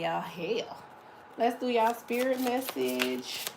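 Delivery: normal talking voice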